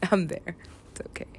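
ordinary talk

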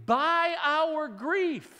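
emphatic peak. Acted. Emphatic sermon climax.

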